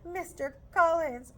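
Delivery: doleful voice. Acted. Mrs Bennet greeting Mr Collins, slow doleful. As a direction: sigh his name mournfully. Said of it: "in a doleful voice".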